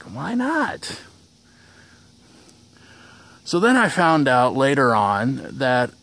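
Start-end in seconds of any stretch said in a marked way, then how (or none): 0.0-1.0 high, baffled question at the clerk; incredulous why not. Retold or imitated. To the clerk about the number, a high baffled question. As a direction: pitch it way up in disbelief. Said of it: "and I'm like".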